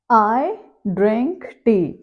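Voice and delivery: very slow with long pauses between words, as if writing; slow dictation. Shown as a demonstration. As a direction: give each word time while writing it. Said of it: "जैसे मैं यहाँ लिखती हूँ".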